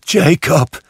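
high, loud, imploring call; pleading. A narrator's reading. Scrooge implores his dead partner, pleading. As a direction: beg him by name. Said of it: "sagte er flehend".

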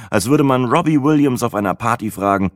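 ordinary delivery

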